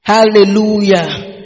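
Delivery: loud, slow, stretched praise shout; calling out praise. Real, not acted. Preacher calling the congregation to praise, long loud shout. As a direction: draw the word out at full volume.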